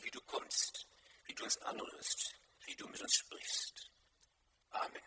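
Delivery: hushed, earnest prayer voice. Acted. Pastor praying to God before the sermon, hushed.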